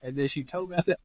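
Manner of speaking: usual speaking voice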